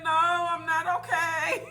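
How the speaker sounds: loud, slow, high insistent "no"; slow, loud mock shout of refusal; loud retold protest. To the nurse who asked if she was okay, a loud retold refusal. Retelling her reply to the nurse.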